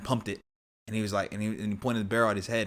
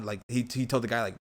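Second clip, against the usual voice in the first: ordinary talk, nothing marked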